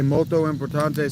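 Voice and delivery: "molto importante" in a mock Italian lilt; Italian pronunciation try; Italian phrase. Shown as a demonstration.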